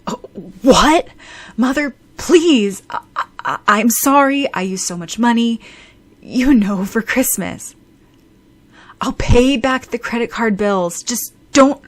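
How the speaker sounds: loud, anxious begging with stammers; panicked pleading. A narrator's reading. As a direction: plead frantically with an angry mother. Begging mother over credit card bills.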